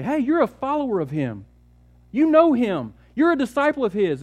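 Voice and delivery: high, pointed accusation; accusing bystander. Acted. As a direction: corner him with the charge. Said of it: "and say".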